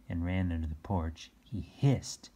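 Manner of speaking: plain reading voice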